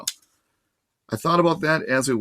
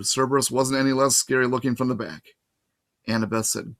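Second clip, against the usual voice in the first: plain reading voice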